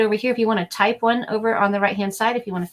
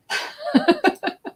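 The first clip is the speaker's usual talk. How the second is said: squealing laugh, much higher. Real, not acted. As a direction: laugh in disgust. Grossed out by roach.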